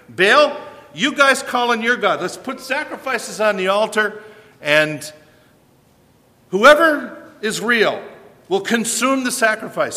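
a hint of a bold prophet calling out his rivals; Elijah challenging. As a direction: throw down the dare to the prophets of Baal. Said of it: "and Elijah's saying".

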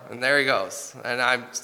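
usual speaking voice

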